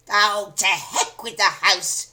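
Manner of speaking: put-on loud, slow screechy old woman's shout; crone shout. A narrator's reading. Grandma dismissing George, screechy shout. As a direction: shout it like a reckless hag. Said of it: "she shouted".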